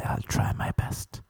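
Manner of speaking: hushed whispered promise. Retold or imitated. Fake priest whispering back to the women. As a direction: say it under your breath. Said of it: "And I'd say".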